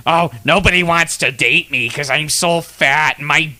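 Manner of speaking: a clumsy mock of her sob story; bad impression of the woman. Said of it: "this is a bad impression probably".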